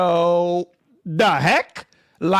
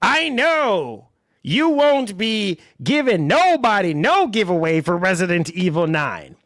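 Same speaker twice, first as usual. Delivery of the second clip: usual speaking voice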